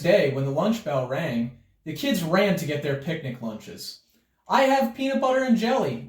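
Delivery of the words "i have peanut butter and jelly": announced. A narrator's reading. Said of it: "Natty announced to the class".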